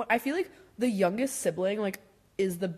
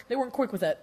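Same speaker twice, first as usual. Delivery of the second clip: normal talking voice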